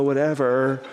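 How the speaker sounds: mock indifferent whatever. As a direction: say "whatever" like a passive-aggressive jerk. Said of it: "now you're just a jerk".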